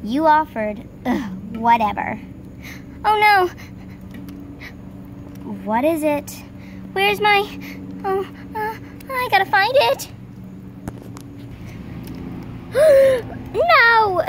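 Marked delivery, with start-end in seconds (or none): 3.0-3.5 alarmed. Acted. Panics over a lost item. 6.9-10.1 high, frantic searching; panicked. Acted. Frantic search for lost item. 10.2-13.5 sharp gasp. Acted. Frantic search for lost item. 13.5-14.2 panicked cry. Acted. Frantic search for lost item.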